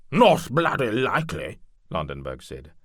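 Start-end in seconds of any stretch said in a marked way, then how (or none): 0.1-1.6 high, loud, eager noble; eager knight lord. Acted. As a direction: demand it, louder and higher. Landenberg scoffing.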